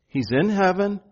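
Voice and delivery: ordinary delivery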